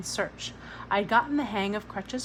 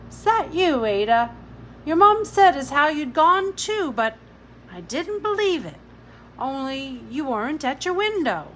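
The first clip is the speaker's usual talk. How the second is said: higher, louder London mother; Billy's mother; 5 semitones higher, 7 dB louder than usual. A narrator's reading. Billy's mother to Ada, loud and heartfelt. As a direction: speak as a fierce working mum.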